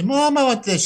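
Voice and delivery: high mock kid voice; whiny kid.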